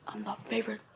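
soft, low murmur; quiet aside. Pretend. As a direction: say it softly to yourself. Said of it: "unvoiced whisper".

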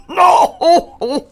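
loud, high gasp through laughter. Acted. Rhinoceros gasps no between laughs. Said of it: "gasped the rhinoceros".

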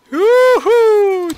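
loud, high-pitched cheer; excited whoop. Real, not acted. Patlamaya coşkuyla bağırıyor. (coşkulu tiz çığlık)

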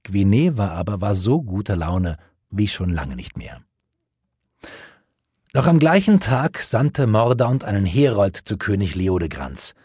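ordinary delivery

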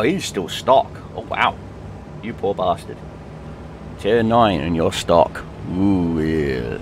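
normal talking voice

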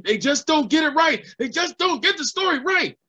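upset outburst. Retold or imitated. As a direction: let the old man's frustration boil over. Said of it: "he freaked out".

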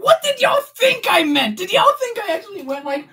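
high-pitched incredulous protest; exasperated. Real, not acted. Streamer protesting to chat, high and exasperated. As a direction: squeak out the disbelief.

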